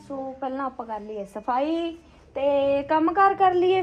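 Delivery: ordinary talk, nothing marked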